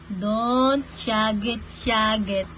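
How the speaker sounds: low and slow, words stretched out; slow hushing. Real, not acted. Parent telling baby not to shout, slow drawn-out hush.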